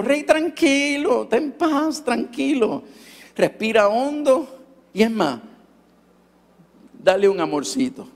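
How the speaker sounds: high, slow, gentle words to calm himself; coaxing himself to breathe and be calm; soothing self-talk. Retold or imitated.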